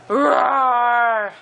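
playful monster roar. Shown as a demonstration. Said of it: "What does a monster say?".

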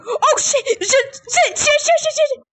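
rapid high panicked cursing; frantic, pitch climbing. Real, not acted. Monsters closing in.

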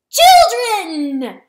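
a shrill screaming call with a laugh in it; loud cry. Acted. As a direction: shriek it high and loud, laughing. Cockatrice cry in battle.

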